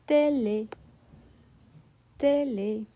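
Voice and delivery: "télé" with two sharp closed é sounds; French é. Shown as a demonstration. Teacher models the word for students, sharp closed é. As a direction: say "télé" the French way, each é tight. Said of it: "For example".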